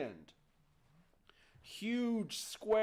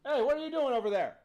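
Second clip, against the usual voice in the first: louder, higher, quick challenge; startling challenge; 6 semitones higher, 5 dB louder, much faster than usual. Acted. Someone spots the boy reaching for the lamp, startling challenge. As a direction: call out sharply to catch a thief. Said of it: "someone sees him".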